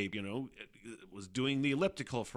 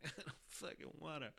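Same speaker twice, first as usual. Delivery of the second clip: higher, very soft mock whine; reluctant mock whine; 5 semitones higher, 17 dB quieter than usual. Giving up at fifteen sit-ups, a reluctant mock whine. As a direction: whine it under your breath, reluctantly.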